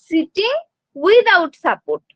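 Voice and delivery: each word drawn out slowly for notes; slow, deliberate classroom dictation. Shown as a demonstration. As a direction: spell it out slowly so students can write. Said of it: "teacher dictating a milestone".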